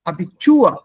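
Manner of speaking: Abitur with a loud final TUR; stress on second half. Shown as a demonstration. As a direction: punch the end of Abitur. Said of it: "The stress is on the second half".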